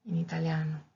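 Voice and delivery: ordinary talk, nothing marked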